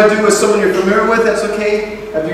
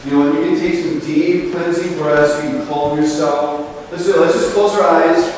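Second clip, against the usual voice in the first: ordinary talk, nothing marked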